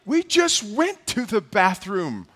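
high, slow, drawn-out disbelief; acted parental exasperation; exasperated dad. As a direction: stretch it out like a fed-up parent. Exasperated dad answering his son.

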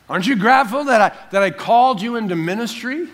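high, loud challenging question; acted reproof. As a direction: play the Lord pressing an ungrateful servant. Voices the Lord reproving him.

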